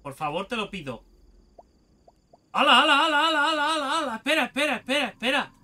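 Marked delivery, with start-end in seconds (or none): 2.5-5.5 loud, high, rapid-fire startled repetitions; real jolt of alarm, words repeated over and over; alarmed outburst. Real, not acted. Startled by the game, real reaction.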